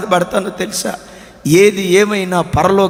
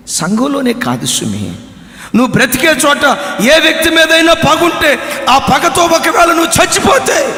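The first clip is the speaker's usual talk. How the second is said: ordinary talk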